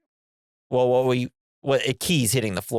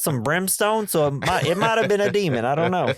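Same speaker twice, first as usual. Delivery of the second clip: put-on New York accent; 7 semitones higher than usual. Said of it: "a very New York demon".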